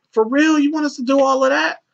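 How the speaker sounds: squeaky high voice of a shocked worker; high mock disbelief. Worker balking at the boss in a high squeal. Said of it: "she's like".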